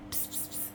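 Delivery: unvoiced psst to call the cat; soft psst-psst cat call; whispered psst calls. Real, not acted. Calling the cat over.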